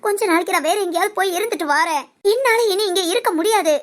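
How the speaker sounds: ordinary talk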